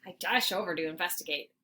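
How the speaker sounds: ordinary delivery, nothing marked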